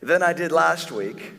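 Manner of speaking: rising, slowed, self-satisfied boast; mock a proud churchgoer bragging; hammed-up smug voice; smug self-praise.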